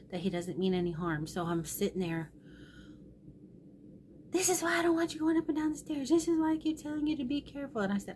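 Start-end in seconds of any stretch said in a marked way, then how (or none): 4.3-7.8 high, rapid imitation of a son's scolding; retold angry scolding. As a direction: rattle off the son's exasperated telling-off in a raised pitch. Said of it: "So he's mad".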